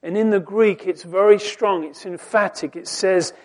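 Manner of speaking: usual speaking voice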